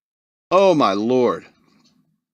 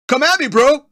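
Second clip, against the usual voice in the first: brash challenge, higher and louder; taunting challenge; 11 semitones higher, 5 dB louder than usual. Pretend. Crab daring the world to fight, brash and loud. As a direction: puff up like a cocky crab picking a fight.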